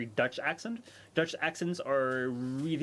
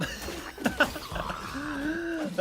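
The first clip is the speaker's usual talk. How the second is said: high burst of real laughter; sheepish high laugh at a blunder; sudden laugh; 12 semitones higher than usual. Real, not acted. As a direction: laugh it off at your own mistake. Laughs after messing up game.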